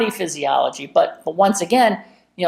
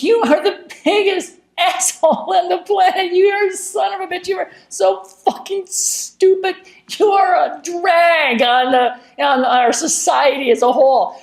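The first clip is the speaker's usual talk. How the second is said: smiling mock tirade; 11 semitones higher than usual. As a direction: berate someone outrageously through a big laughing smile. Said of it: "I'm smiling and I'm laughing".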